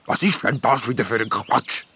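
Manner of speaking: scoffing creature voice; dismissive gnome. A narrator's reading. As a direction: brush off the nymph's nonsense. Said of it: "sagt der Gnom abschätzig".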